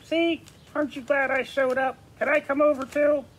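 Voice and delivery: high, eager puppet character. Acted. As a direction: pitch it up like a pushy puppet. Puppet asks to come over.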